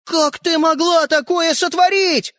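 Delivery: high, slow cry of outrage; outraged shout. A narrator's reading. Brother scolds sister over lost toy, outraged. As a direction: cry out in disbelief, stretching it.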